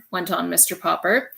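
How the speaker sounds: ordinary delivery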